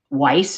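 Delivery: Weiss said as "wice", rhyming with ice; careful corrected pronunciation. Shown as a demonstration. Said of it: "I'm gonna say this right".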